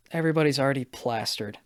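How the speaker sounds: ordinary delivery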